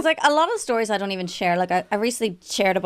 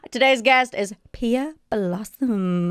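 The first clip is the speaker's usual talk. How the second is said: announcer voice; 2 semitones higher, much slower than usual. Acted. Host announces the guest.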